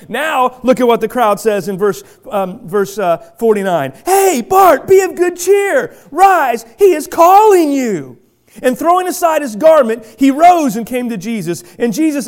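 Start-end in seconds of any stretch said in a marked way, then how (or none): 4.0-8.2 high, loud, cheering call; eager call. Acted. Crowd urging blind Bart to rise, excited calling out. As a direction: call across the crowd with joy.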